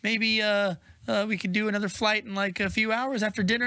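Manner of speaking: put-on high, stammering boy voice; hesitant kid character. Boy telling his dragon he's going inside, hesitant. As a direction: awkwardly excuse yourself, full of uhs. Morty hesitantly excuses himself.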